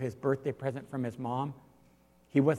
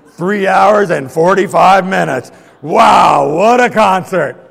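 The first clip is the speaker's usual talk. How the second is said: excited shout; 6 semitones higher, 19 dB louder, slower than usual. Retold or imitated. Concertgoers posting excitedly on Facebook.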